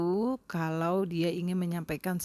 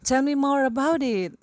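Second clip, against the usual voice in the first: higher, louder eager request; eager interest; 8 semitones higher, 8 dB louder than usual. Shown as a demonstration. Listener to a friend telling a story, eagerly interested. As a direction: sound keen to hear more. Showing attention to speaker.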